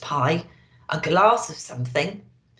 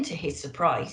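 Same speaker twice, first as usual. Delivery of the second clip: plain reading voice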